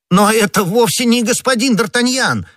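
high-pitched astonished protest; crying out. A narrator's reading. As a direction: cry out that they have the wrong man. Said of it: "вскричал Бонасье".

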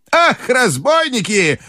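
high, drawn-out outraged cry; scandalised old lady; shouting grandmother. A narrator's reading. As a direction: wail indignantly, high and slow. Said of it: "закричала она".

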